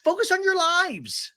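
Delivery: very high mock scream, faintly faux British; high put-on voice, insistent and repetitive; Shannon. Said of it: "Shannon screams".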